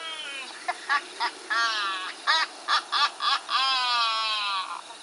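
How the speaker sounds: booming mocking ghost laughter. A narrator's reading. Ghost rider laughing as he vanishes, booming laugh. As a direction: let the laugh ring out like a haunting.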